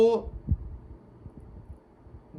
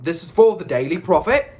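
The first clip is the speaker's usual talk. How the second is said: loud, irritable announcement; snarl; 2 semitones higher, 8 dB louder than usual. A narrator's reading. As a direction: insist loudly, as an annoyed photographer. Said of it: "he snarled at Ron".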